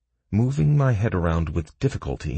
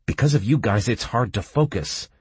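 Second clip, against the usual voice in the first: snapping; 5 semitones higher than usual. A narrator's reading. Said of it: "I snapped".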